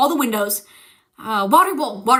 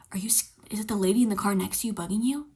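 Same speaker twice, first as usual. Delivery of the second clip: leaning in to whisper a secret; hushed, breathy question; 3 semitones lower, 7 dB quieter than usual. Retold or imitated. Mom leaning into the car, whispering about the lady. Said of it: "she goes".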